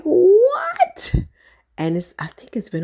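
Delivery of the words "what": squealing high what with a laugh; shocked what. Retold or imitated. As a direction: burst out in disbelief. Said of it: "I was like".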